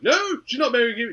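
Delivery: hammed-up outburst; mock furious dad. Dad goes crazy.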